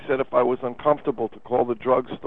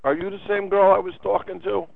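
annoyed; 6 semitones higher than usual. Acted. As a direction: push back like you're fed up. Caller angry about being put on hold.

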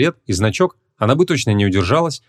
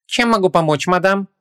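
high, honeyed salesman voice; sugary shopkeeper; 9 semitones higher than usual. A narrator's reading. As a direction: greet a customer through a syrupy smile. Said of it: "Расплылся в слащавой улыбке хозяин магазинчика".

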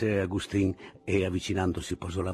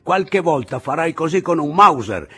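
lightly voiced old man, raised and higher; old Anselmo warning the sentry; stern old man; 10 semitones higher, 11 dB louder than usual. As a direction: scold the careless guard.

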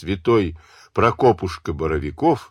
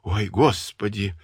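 ordinary delivery, nothing marked

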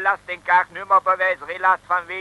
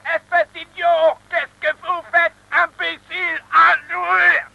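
angry French scolding; 10 semitones higher than usual. Acted. French gendarme scolds the stopped driver.

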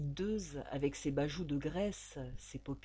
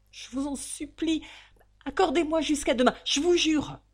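higher and louder, pleading; desperate begging; 8 semitones higher, 9 dB louder than usual. Acted. Valentine begging the landlady for time, frightened. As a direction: beg for one more day.

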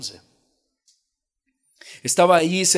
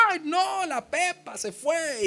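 high mock wailing villager; 12 semitones higher, 4 dB quieter, slower than usual. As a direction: squeal it like a panicked villager. Crowd cries over lost pig.

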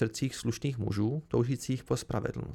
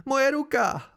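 high, loud gasped cry of pain; bolestný výkřik; 11 semitones higher, 5 dB louder, slower than usual. A narrator's reading. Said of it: "lapal po dechu". (vyjeknutí bolestí)

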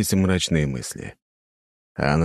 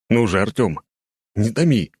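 higher-pitched, mildly impatient plea; impatient urging; 5 semitones higher, 3 dB louder than usual. A narrator's reading. Volodya cannot wait for the news, mildly impatient. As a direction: urge him on, losing patience a little. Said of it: "не выдержал Володя".

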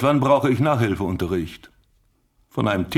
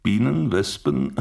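normal talking voice

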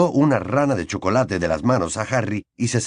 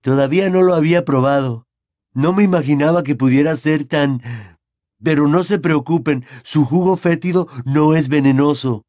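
nervous; 5 semitones higher, 5 dB louder than usual. A narrator's reading. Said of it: "añadió, nervioso".

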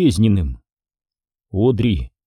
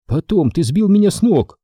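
Jenny snapping at Tom; indignant retort; 8 semitones higher than usual. Acted. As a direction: throw it back at him.

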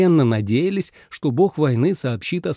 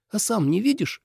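high, irritated retort; higher-pitched peevish comeback; snapping back; 9 semitones higher than usual. A narrator's reading. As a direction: throw the question back testily. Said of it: "огрызнулась Суэлань".

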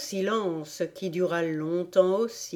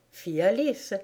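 ordinary delivery, nothing marked

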